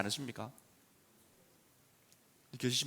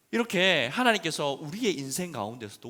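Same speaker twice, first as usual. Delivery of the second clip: normal talking voice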